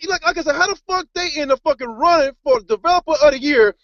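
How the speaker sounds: high, heated cursing tirade, pitch spiking up; angry rant. Real, not acted. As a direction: vent full fury at the game company, voice climbing. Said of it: "I wanna do a little rant".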